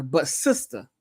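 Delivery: "sister" said the close, affectionate way; sister with closeness. Shown as a demonstration. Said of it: "That place is more of a closeness".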